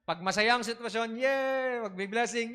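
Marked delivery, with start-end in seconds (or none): 1.2-1.8 higher, bright mock cheer. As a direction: cheer like a happy believer. Mock cheer when happy. (masiglang pabirong sigaw)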